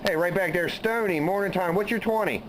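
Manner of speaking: high, calling out over the band; CB radio call. Real, not acted. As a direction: hail a buddy on the CB.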